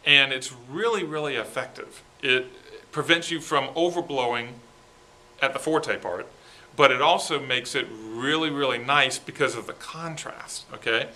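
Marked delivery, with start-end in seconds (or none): none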